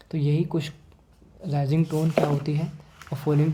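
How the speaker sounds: ordinary delivery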